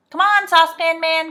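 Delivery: high, loud call. A narrator's reading. Joe summons the saucepan man, calling out. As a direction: call to someone at a distance. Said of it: "called Joe".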